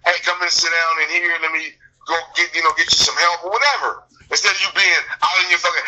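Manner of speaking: normal talking voice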